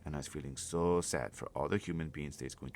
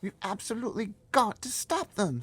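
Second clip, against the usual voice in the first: Sophie, high and urgent, much louder; a small girl pleading to stop the giants; high girl's voice; 10 dB louder than usual. Acted. Girl begging the giant, high and loud.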